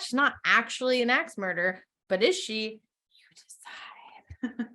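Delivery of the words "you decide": whisper. Pretend. Spooky tease about murder verdict.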